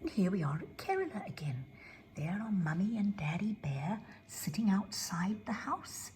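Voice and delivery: soft unvoiced story narration; whispered storytelling. A narrator's reading. Narrator welcomes children in a hushed whisper.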